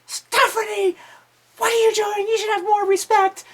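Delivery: very high, loud screechy scolding; hammed-up grandmother shriek; scandalized grandmother. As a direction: screech like an outraged granny. Imitating outraged grandmother catching them.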